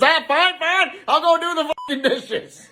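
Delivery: high, hurried surrender; frantic giving in. Acted. Dad gives in at the dinner table, high and frantic.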